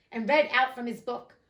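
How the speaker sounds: ordinary delivery, nothing marked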